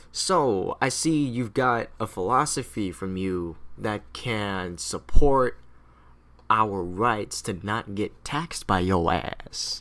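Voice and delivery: slow, deliberate, drawn-out address; scheming colonists. Acted. Colonists addressing John Locke about taxes, slow and deliberate. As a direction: spell it out slowly, with mock consideration. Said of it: "colonists are looking at him being like".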